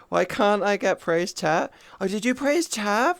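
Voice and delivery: usual speaking voice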